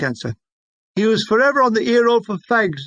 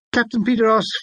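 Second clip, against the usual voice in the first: plain reading voice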